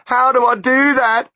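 very high and loud desperate plea; pleading. A narrator's reading. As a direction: beg for a way out. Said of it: "he pleaded".

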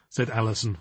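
plain reading voice

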